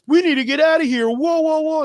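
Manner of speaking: high, loud, fast mock alarm; mock panic. Fan mocking Mando fleeing the robot, high frantic mock panic. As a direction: blurt it like a cartoon hero fleeing. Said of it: "and he's like".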